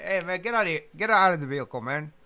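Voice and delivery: officer ordering him out, loud; barked order. Retold or imitated.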